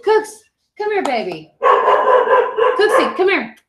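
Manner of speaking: high sing-song dog call. Real, not acted. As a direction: coax the dog over in a high pet voice. Calling her dog over on stream.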